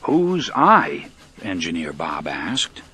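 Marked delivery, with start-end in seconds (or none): 0.0-1.1 loud, higher, stern challenge; big stern voice. A narrator's reading. Engineer suspecting someone's playing a joke, biggest sternest voice. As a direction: boom it out sternly. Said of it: "spoke in his biggest, sternest voice".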